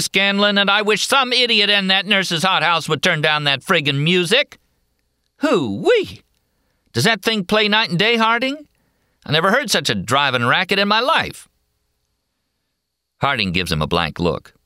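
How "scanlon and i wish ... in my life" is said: irritated cowboy. Acted. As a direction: gripe about the racket. Annoyed McMurphy complaining about the music.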